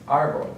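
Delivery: ÁR-bol, hit the first syllable; stress on first syllable. Shown as a demonstration. Teacher showing the accented syllable, stress on first syllable. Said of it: "accent mark on the first syllable".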